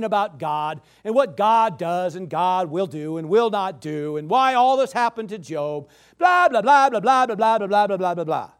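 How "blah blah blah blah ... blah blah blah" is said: rapid blahs, starting loud and sliding down; dismissive mock babble, fading at the end; mocking chatter. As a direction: imitate people droning out their opinions. Mimics everybody's endless opinions about God.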